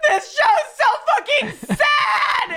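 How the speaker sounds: high, loud, drawn-out mock wail; scream crying. Telling Joe why she's sobbing at a show, mock scream crying. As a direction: wail it out, hammed up, half laughing. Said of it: "fucking started scream crying".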